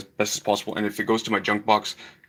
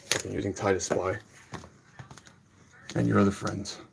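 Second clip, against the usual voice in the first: much softer, bitter cursing under his breath; seething mutter; 2 semitones lower, 7 dB quieter than usual. Real, not acted. Angry teacher cursing Ty and his friends under his breath.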